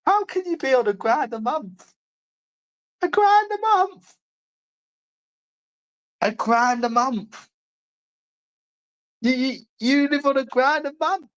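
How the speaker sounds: high, incredulous mocking voice; sneering falsetto disbelief. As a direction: squeak it out, scoffing. Ridiculing living on a grand.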